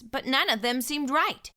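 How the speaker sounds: ordinary delivery, nothing marked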